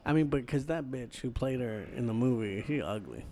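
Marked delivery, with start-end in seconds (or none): none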